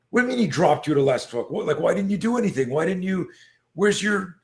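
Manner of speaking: high, rapid-fire disbelief; exasperated coach, acted out; retold incredulity.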